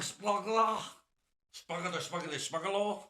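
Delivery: mock German with a Mexican accent, pitched high; silly high Mexican-German accent. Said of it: "German with a Mexican accent".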